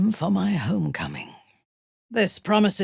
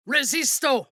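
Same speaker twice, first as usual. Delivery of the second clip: high, sharp incantation; spell cry; 10 semitones higher than usual. Acted. Casting a protective spell mid-fight, high. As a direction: snap out the spell word.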